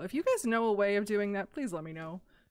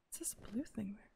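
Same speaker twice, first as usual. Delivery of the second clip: whisper; 4 semitones higher, 13 dB quieter than usual. Real, not acted. Puzzling at something.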